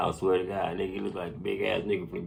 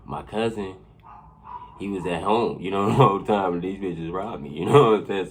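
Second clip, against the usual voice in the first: ordinary talk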